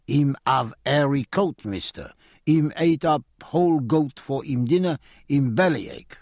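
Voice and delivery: lightly voiced foreign showman making excuses; a touch of a wheedling foreigner; mildly put-on foreign bear man; broken-English bear leader.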